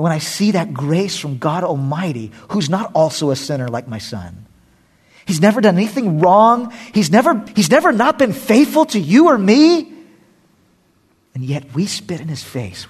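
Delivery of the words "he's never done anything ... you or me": high and loud, ringing out; preacher lifting the congregation; fervent preaching. Real, not acted. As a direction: proclaim God's faithfulness at full force. Preaching climax.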